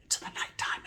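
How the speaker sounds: hushed storyteller whisper; a whispered lights-out hush. Acted. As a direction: breathe it out like lights-out. Setting the night scene.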